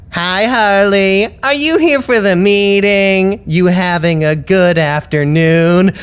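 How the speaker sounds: high, slow, sing-song mock-friendly voice; syrupy mock greeting, drawn out; mocking sweet greeting. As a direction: imitate their fake cheerful welcome. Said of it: "they're all like".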